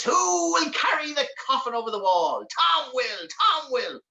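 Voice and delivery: high, sing-out demon chant; high-pitched goblin call and answer; little demon. Acted. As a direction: call it out like a gleeful imp. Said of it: "like little demons".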